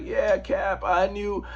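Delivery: hint of a president thanking a soldier; Bill Clinton. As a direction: play a folksy president lightly. Bill Clinton quoted thanking Cap.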